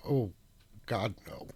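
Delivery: ordinary talk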